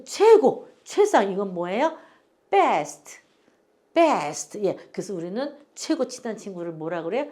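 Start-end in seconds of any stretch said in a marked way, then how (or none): 2.5-4.6 best with a firm B, lips closed at the start; clear B in best. Shown as a demonstration. Teacher modelling best against vest for learners, clear B. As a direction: say best crisply with a B, not a V. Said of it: "우리 발음 정확하게 구분해서 하셔야".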